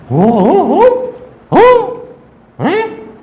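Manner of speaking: very high hoots rising to a puzzled हैं. Retold or imitated. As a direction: whoop like startled cave people. (बहुत ऊँची आवाज़ में नकली हुंकार)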